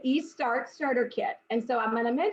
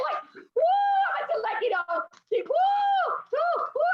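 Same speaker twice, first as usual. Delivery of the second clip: overwhelmed whooping. Real, not acted. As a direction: whoop it out, moved close to tears. Overcome hearing a moving story.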